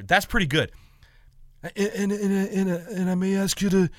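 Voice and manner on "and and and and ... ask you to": higher, halting Godfather don voice; hammed-up mafia boss, words mumbled and run together; Marlon Brando. Godfather asking for a future favor, in a mumbled impression. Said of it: "the Marlon Brando one for The Godfather".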